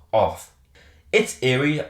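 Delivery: ordinary delivery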